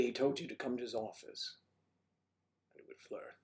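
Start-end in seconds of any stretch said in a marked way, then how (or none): 2.7-3.3 whisper. Real, not acted. Unvoiced aside.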